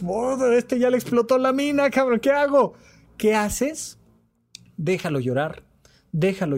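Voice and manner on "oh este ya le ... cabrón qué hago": mock panic. Listener watching a crying friend, hammed-up panic. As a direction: play the helpless listener in a light panic.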